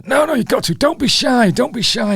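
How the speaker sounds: put-on insistent, very high Yorkshire accent. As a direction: urge them on in a high mock Yorkshire voice. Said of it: "he's really insisting".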